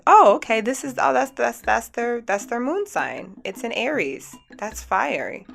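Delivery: loud, high "oh okay" of realizing; an aha moment, half acted; sudden realization. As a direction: it all clicks at once, then settles. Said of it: "So it's like".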